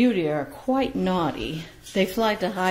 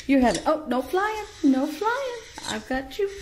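much higher, begging the bird not to fly; high pleading pet talk; 8 semitones higher than usual. Real, not acted. Owner begging Beauty not to fly away, high pleading.

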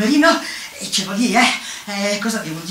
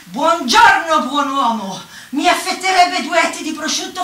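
declaimed order; 6 semitones higher, 4 dB louder than usual. Acted. Customer greeting the shopkeeper, loud high declamation. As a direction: proclaim the shopping order to the counter. Orders at counter, forced rhyming.

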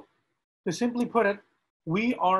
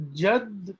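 slowed down; 2 semitones higher than usual. Shown as a demonstration. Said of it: "if I slow this down".

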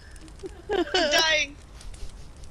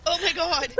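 ordinary talk, nothing marked

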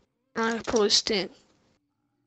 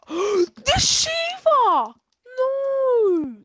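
high, loud, slow shout with a gasp; real exasperated yell; anguished shout; 12 semitones higher, 4 dB louder, much slower than usual. Real, not acted. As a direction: howl it out at being killed again. Killed once more by that weapon.

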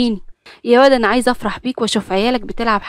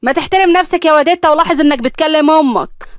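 higher, louder scolding; angry scolding; 6 semitones higher, 4 dB louder than usual. Acted. Angry mother scolding her disrespectful son, raised voice. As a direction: put him in his place sharply. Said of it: "ابتسام اتنرفزت وقالت له".

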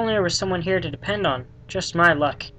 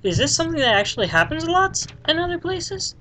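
incredulous question; 11 semitones higher than usual. Real, not acted. Incredulous at the notice.

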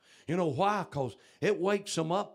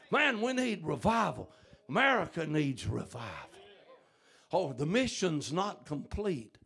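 usual speaking voice

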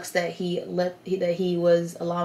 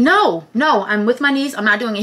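raised, higher voice refusing firmly; retold protest, louder and higher; insistent refusal; 4 semitones higher, 8 dB louder than usual. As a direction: push back firmly, voice lifted. Acting out her refusal to dad.

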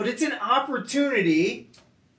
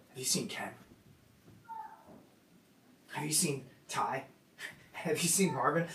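low, soft, proud pointing out; whisper-close, proud of each one; hushed Jesus voice; 6 semitones lower, 13 dB quieter than usual. Retold or imitated. Jesus showing off his followers, hushed and low.

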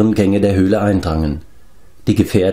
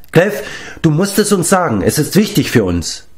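urgent plea, starting high and settling; insistent pressing; 8 semitones higher than usual. A narrator's reading. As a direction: press him earnestly. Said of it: "drängte Taran". (eindringlich drängend)

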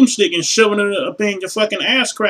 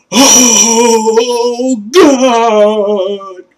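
mock moan; 4 semitones higher, 6 dB louder than usual.

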